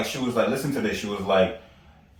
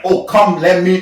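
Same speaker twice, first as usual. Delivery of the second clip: louder, higher playful offer; mock eager bro; 6 semitones higher, 8 dB louder, slower than usual. Joking about rubbing a male friend's shoulders, hammed-up. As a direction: ham up a buddy offering a rubdown.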